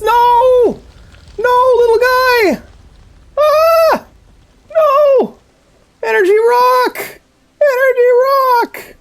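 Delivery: very high, slow, crying wails; anguished wailing. Real, not acted. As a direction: mourn the energy rock in despair. Character falls, rock lost.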